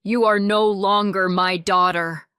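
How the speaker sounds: loud angry father's shout. A narrator's reading. Father forbidding the marriage, shouting. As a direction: bellow it at his daughter. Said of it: "he shouted".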